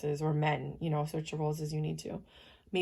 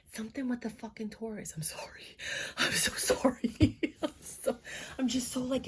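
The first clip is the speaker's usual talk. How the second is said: high, breaking into whispers and broken laughs; real emotion catching the voice, higher and faltering; overcome, apologizing; 6 semitones higher than usual. Real, not acted. Reader apologizes, so invested in the reading, overcome. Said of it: "invested in this".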